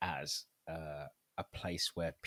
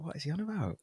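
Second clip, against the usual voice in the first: bewildered; 11 semitones higher than usual. Retold or imitated. Acting out bewildered reaction to chant.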